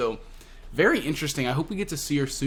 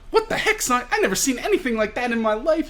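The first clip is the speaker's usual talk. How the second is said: much higher, fast cartoon codger; old man voice; 10 semitones higher than usual. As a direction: squeak it out like a tiny grumpy grandpa. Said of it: "He's talking like an old man".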